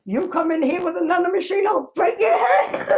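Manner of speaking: high, quick mock-angry warning; hammed-up threat. Worker warning the machine salesman, mock threat. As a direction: threaten the salesman loudly, on the edge of laughter. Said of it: "Just like that".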